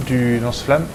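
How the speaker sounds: normal talking voice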